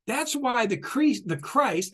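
usual speaking voice

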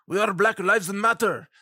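badly faked Middle Eastern accent; horrible terrorist accent. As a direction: do a hammed-up Middle Eastern accent with added vowels. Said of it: "horrible accent impersonation".

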